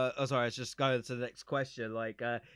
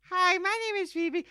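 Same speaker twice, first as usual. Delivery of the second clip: put-on much higher, childlike voice; little kid voice. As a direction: squeak it out like a small child. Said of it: "Vivi, a little kid".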